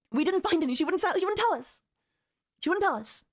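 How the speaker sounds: getting heated over the missing evidence; frustrated. Real, not acted. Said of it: "I'm getting a little worked up and I'm getting a little frustrated".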